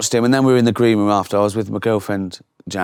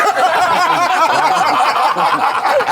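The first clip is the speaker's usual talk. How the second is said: loud high laugh; 4 dB louder than usual. Real, not acted. Reacting to [name]'s insult.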